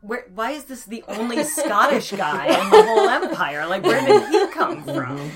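high, loud, laughing through the question; incredulous giggle at an odd casting choice; laughing disbelief. Retold or imitated.

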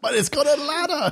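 high squeal through laughter; laughing punchline. Acted. Podcaster quoting a character to co-hosts, laughing high. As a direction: squeak out the quote while cracking up.

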